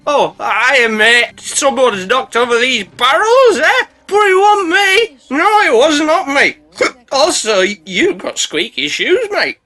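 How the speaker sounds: high, slow, slurry innocent voice; drunk denial. Acted. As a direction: play the guilty drunk acting innocent. Drunk boat denies blame.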